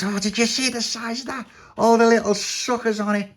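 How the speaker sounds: high, exclaiming voice; amazed character. A narrator's reading. Tony amazed at tentacle.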